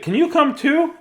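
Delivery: high, loud friend voice; eager friends. Acted. Friends inviting child to the ballpark, eager.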